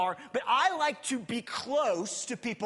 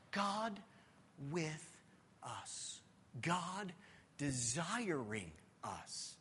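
much softer and lower, slow; hushed reverence; 4 semitones lower, 9 dB quieter, much slower than usual. Acted. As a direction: savor each word.

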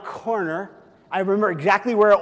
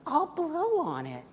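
mock soothing mom; 9 semitones higher, 7 dB quieter, slower than usual.

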